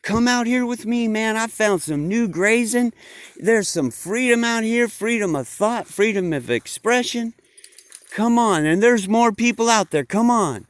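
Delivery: he's found freedom and can't wait to share it; eager Bob. Acted. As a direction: sheep urging friends out of the pen. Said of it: "And Bob went back and said".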